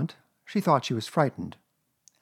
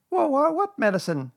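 very high stammering little voice; alarmed Piglet; 4 dB louder than usual. A narrator's reading. Piglet hearing about medicine, stammering alarm. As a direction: stammer the question in a squeaky panic.

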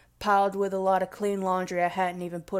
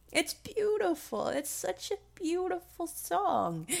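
choked up, higher and much softer; real tears welling as she speaks; soft, teary awe at a song; tearful; 7 semitones higher, 8 dB quieter than usual. Real, not acted. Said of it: "I'm even tearing up right now".

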